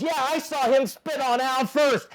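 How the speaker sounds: high, worked-up mock accusation; retold accusation. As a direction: act out joining the argument. Crew chief answering in acted quarrel.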